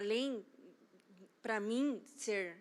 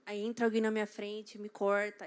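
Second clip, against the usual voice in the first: ordinary delivery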